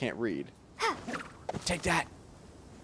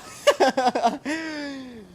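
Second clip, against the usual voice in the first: high, falling burst of laughter; high giggle; 10 semitones higher than usual. Real, not acted. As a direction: let the giggle spill out and drop. Laughing at bean attack.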